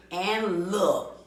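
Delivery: normal talking voice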